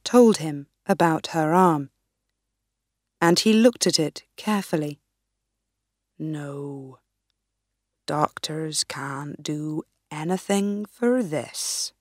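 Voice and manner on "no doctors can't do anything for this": put-on low, hushed old man's voice; grave and slow, like an ancient seer; wise old sage. Wise man speaks in the story.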